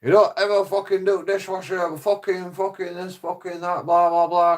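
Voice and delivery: high, nagging mock voice of his mum; mocking drunk mum. Son mocking his drunk mum's complaints, high whiny mimicry. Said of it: "she goes".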